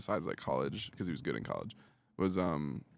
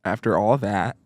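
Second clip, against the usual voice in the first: Cat impression; 7 dB louder, slower than usual. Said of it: "that's my Cat impression".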